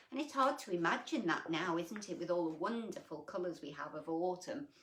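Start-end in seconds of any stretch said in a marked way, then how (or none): none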